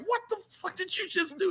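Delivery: high, hushed mock outrage; incredulous confronting. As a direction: demand it in a quiet, squeaky voice. Retelling confronting the shusher.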